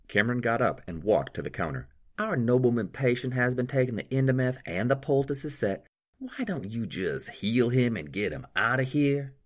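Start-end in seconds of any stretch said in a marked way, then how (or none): 2.2-9.3 lightly voiced nurse, higher; a hint of a brisk nurse. Marie speaks in story.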